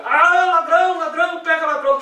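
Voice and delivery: loud, high, hurried cry of alarm; imitated crowd shouting. Everyone joins the cry to catch a thief, mock shouting. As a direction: mimic a whole street yelling after a thief. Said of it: "começa a gritar".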